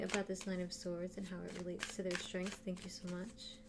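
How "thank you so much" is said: quiet thanks. Real, not acted. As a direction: say thanks softly, almost to yourself. Thanking guides under her breath.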